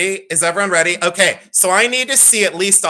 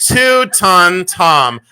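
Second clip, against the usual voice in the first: slow amused drawl; 2 semitones higher, 6 dB louder, much slower than usual. Real, not acted. Mocking own baby photo.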